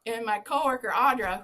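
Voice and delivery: ordinary talk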